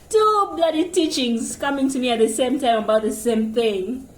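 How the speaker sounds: normal talking voice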